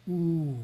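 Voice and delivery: a long rounded Ooh, held; prolonged oo. Shown as a demonstration. Teacher demonstrating the second syllable of Om, held oo. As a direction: draw Ooh out like in roof. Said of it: "as in roof, pronounced oo, but prolonged".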